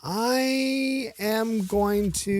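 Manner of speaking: game-show host delaying the ruling; suspenseful drawn-out verdict. Pretend. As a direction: stretch every word to hold the suspense. Host teases the scoring decision.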